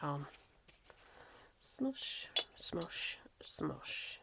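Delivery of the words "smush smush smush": soft, whispery murmur; hushed smushing. Real, not acted. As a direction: murmur it softly as you press the paint on. Murmuring along while smushing paint.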